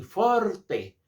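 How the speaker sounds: "forte" high and loud, with a clear final "e"; Italian pronunciation. Shown as a demonstration. Teacher teaching the Italian music term, forceful Italian "forte". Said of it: "o italiano também fala assim".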